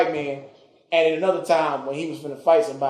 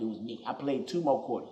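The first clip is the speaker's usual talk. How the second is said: ordinary talk, nothing marked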